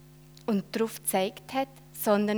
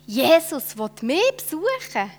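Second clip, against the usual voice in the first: amazed; 7 semitones higher, 7 dB louder than usual. Acted. Zachäus amazed, dizzy.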